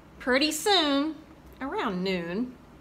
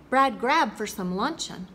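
usual speaking voice

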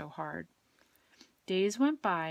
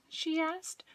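usual speaking voice

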